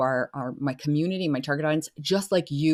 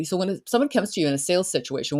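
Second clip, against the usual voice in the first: ordinary talk, nothing marked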